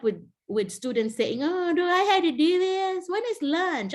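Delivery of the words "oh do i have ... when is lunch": higher, whiny mock complaint; hammed-up whine of a bored teen; imitating a student whining to the teacher. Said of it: "they're complaining and whining".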